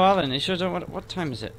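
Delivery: ordinary delivery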